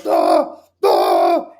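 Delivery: low, loud barking dog noises; imitated dog barking. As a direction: bark like a dog hurling itself at the door. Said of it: "throwing itself against the door, just like".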